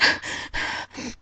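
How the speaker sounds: dry sob. Retold or imitated. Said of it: "I'm making, like, the noise of".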